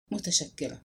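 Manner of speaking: softly murmured, understated; murmured thanks. A narrator's reading. Shy visitor thanking her host, in a soft murmur. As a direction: say it under your breath, softly. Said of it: "فهمست".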